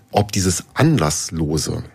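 "anlasslose" slowed right down and punched on the first syllable; "anlasslose" drawn out with a heavy, deliberate emphasis; stress on ANLASSLOSE. Real, not acted. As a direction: lean hard and slowly on "anlasslose". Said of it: "mit Betonung auf anlasslos".

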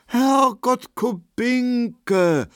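high, drawn-out exclamation; astonished. A narrator's reading. Markowski playing his role to Kubinke, astonished. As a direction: cry the name in put-on amazement. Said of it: "rief Markowski erstaunt, und er spielte seine Rolle".

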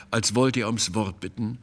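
usual speaking voice

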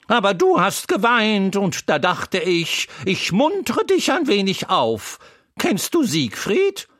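put-on a chatty horse teasing the crying boy; Troubadour the talking horse. A narrator's reading. Horse Troubadour comforting the crying boy Wendel, high and loud.

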